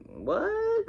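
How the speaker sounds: very high-pitched, incredulous yelp; hammed-up high surprise; mock shocked. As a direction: squeak it out in mock disbelief. Acted shock at the accusation.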